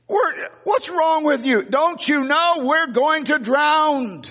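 high, slow, hammed-up panic; frantic disciples. Disciples scolding sleeping Jesus, high hammed-up panic. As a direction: whine it like they're sinking. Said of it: "the disciples are going bonkers".

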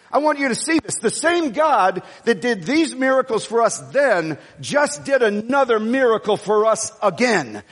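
ordinary delivery

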